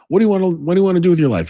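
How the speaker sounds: fast, high, loud retold grilling; urgent demand. Driver grills back-seat passenger in film, urgent. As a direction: fire the question at them, acting it out.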